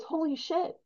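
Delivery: a priest caught off guard, gasping it out; stunned priest. Retold or imitated. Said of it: "And the priest goes".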